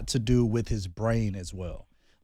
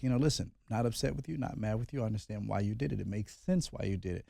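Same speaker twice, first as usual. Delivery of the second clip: much softer, calm reassuring words to a son; soft fatherly reassurance, retold; gentle reassurance; 4 semitones lower, 11 dB quieter than usual. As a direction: talk him down softly, no anger.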